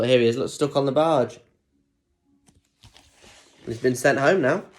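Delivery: plain reading voice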